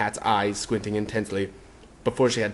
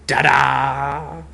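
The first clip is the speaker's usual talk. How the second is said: proud ta-da; 4 semitones higher, 4 dB louder than usual. Acted. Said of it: "exclaimed proudly".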